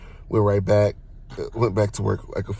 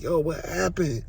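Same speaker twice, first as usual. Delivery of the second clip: dazed confusion; 7 semitones higher, 5 dB quieter, slower than usual. Retold or imitated. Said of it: "I'm like".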